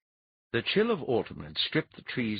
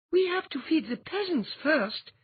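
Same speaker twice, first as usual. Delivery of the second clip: put-on much higher young queen's voice, firm; queen's declaration; 3 dB louder than usual. A narrator's reading. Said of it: "Andine declared".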